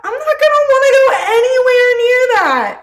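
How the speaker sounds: high, loud mock horror; over-the-top, high-pitched refusal; dramatic refusal. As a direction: recoil loudly from a freak-out. Voicing the universe recoiling from Karen.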